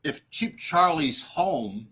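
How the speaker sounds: usual speaking voice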